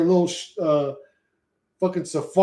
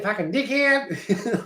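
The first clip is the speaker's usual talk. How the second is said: Australian accent, clipped together with a laugh; quick Australian accent; 5 semitones higher, much slower than usual. Shown as a demonstration. Streamer explaining how Australians talk, quick Australian accent demo. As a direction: rattle it off like an Aussie. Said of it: "like, all quick".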